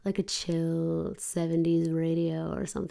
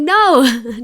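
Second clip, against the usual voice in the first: blunt retold no; 6 semitones higher, 13 dB louder than usual. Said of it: "And I'm like".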